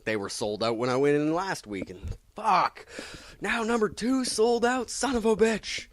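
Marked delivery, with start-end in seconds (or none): 2.3-5.9 much higher mock outrage; exasperated whine; frustrated. Real, not acted. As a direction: vent about the sold-out issue. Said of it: "frustration at sold-out comic".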